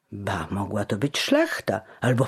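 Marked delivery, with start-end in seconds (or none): none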